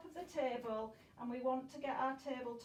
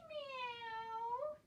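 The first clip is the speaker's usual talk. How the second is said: high, soft, rising kitten meow; mewing like a cat; 11 semitones higher, 4 dB quieter than usual. Pretend. Teacher showing the class a cat's noise, high and soft. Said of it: "Let's do our meow".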